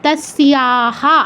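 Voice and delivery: "তস্যাহা" as tas-siyaa-haa, drawn out slowly; full final h. Shown as a demonstration. Teacher applying the rule, "তস্যাহা" as slow tas-siyaa-haa. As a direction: stretch "তস্যাহা", sounding the closing haa. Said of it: "এরকম হবে".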